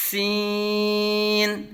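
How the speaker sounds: سین held very long on a level tone after a sharp hissing s; whistling sharp S. Shown as a demonstration. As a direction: start سین like a whistle, then stretch the vowel out. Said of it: "سیٹی کی طرح تیز آواز نکلے گی".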